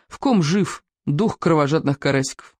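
usual speaking voice

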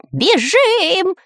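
very high stretched yell; Дырка's frantic cry. A narrator's reading. Spy flees the dinosaur, frantic cry. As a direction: let out a high cry of panic. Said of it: "истошно закричал шпион Дырка".

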